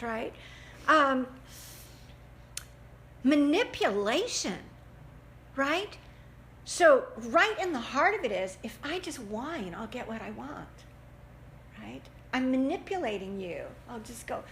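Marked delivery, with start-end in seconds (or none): none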